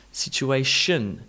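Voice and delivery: situation slowly, ending in shun; schwa ending. Shown as a demonstration. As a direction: isolate the reduced shun in situation. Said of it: "add in the all-important schwa".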